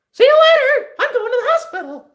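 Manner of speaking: very high, loud cartoon falsetto; Mickey Mouse. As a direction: squeal it out like a cheery cartoon mascot hosting a kids' show. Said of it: "is the Mickey Mouse voice".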